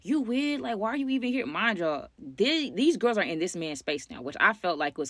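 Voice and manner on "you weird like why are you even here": higher-pitched mock indignant challenge; arguing. Girls confronting Ted in his room, mock arguing. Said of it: "they get to arguing".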